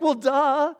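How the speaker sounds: high, sing-song well duh; congregation's eye-rolling duh; mock duh. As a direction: tease the obvious. Mocking obviousness.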